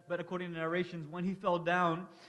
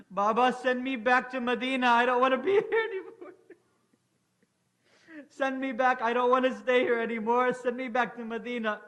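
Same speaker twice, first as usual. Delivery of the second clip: higher, louder, sobbing plea; tearful begging; 5 semitones higher, 6 dB louder than usual. Acted. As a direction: cry out to be sent home. Daughter begging to go home.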